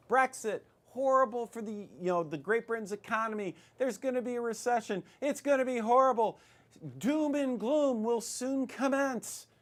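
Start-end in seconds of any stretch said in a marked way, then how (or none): 0.1-1.7 high, loud, breaking-news urgency; hammed-up alarm, raised voice; mock alarm. Mimicking experts' alarm over Brexit. 2.2-9.5 high-pitched hammed-up dread; mock doom-mongering. Mimicking experts' doom predictions.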